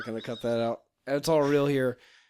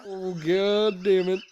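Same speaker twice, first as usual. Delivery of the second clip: mock outrage; 4 semitones higher, slower than usual.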